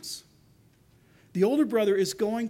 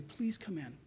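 soft, much quieter begging; quiet plea; 3 semitones lower, 9 dB quieter than usual. Retold or imitated. God begging the lost to come home, hushed and pleading. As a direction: murmur it like a tender appeal. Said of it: "he will go out and beg you".

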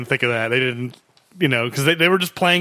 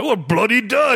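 mock Cockney; 6 semitones higher, slower than usual. Host quoting the henchman's grenade line, mock Cockney. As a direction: exclaim it in a put-on Cockney accent. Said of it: "Does he say bloody".

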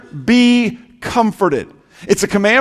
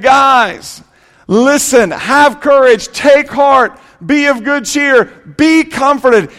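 raised, urgent voice of encouragement; impassioned preaching; 4 semitones higher, slower than usual. Acted. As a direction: preach it as a rousing call, phrase by phrase. Preacher voices Jesus encouraging the disciples.

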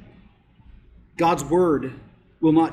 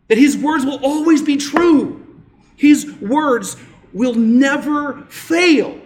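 full-force preaching; 7 semitones higher, 6 dB louder than usual. Acted. Pastor preaching to the church, full force. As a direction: preach it out to the congregation with rising power.

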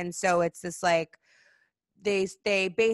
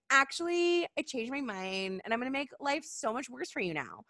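softer, sly reversal after a high "actually"; Pharaoh takes it back; 5 semitones higher, 4 dB quieter than usual. Retold or imitated. Pharaoh going back on his word, soft and sly. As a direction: turn smug and menacing. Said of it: "And Pharaoh's like".

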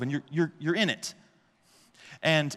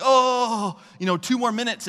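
alarmed; 8 semitones higher, 5 dB louder, slower than usual. Retold or imitated. Parent alarmed at baby's danger.

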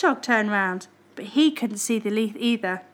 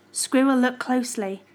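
usual speaking voice

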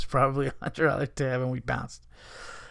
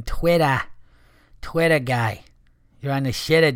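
Boston accent; 4 dB louder than usual. Shown as a demonstration. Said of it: "as they say in Boston".

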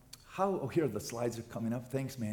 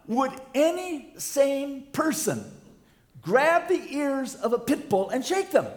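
loud, raised, emphatic preaching; preaching at full force; 10 semitones higher, 9 dB louder than usual. Acted. Pastor warning the church against meddling, loud preaching. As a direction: drive the point home to the congregation.

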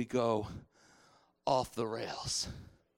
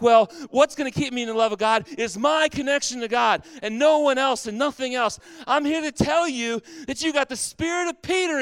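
higher, louder preaching at full force; fervent preaching; 10 semitones higher, 8 dB louder than usual. Acted. Pastor urging the congregation to stop judging, fervent preaching. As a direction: press the congregation hard, urgent.

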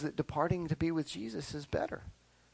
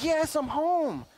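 put-on high child's voice, slow and drawn out; slow relieved child; 11 semitones higher, 5 dB louder, slower than usual. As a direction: savor being home, slowly, like a kid. Child voice repeated slower.

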